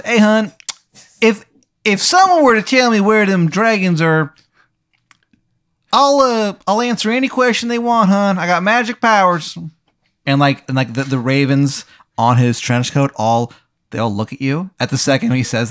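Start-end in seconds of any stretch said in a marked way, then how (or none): 0.0-9.6 high, loud, badly faked Maryland voice; bad impression of a Maryland local, pitched way up; terrible Maryland accent. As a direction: ham up a cartoonish Maryland accent. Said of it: "he has a terrible accent".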